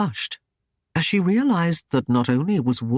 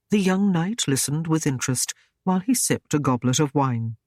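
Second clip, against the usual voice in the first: usual speaking voice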